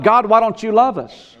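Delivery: high, plaintive cry to God. Retold or imitated. People crying out in trouble.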